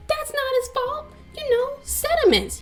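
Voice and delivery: very high, held monotone pitch; exaggerated high-pitched excuse-making; mock defence. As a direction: plead his case in a squeaky-high voice.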